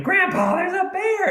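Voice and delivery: put-on very high, eager child's cry; excited kid. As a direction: shout the news like a thrilled boy. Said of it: "And I'm all excited".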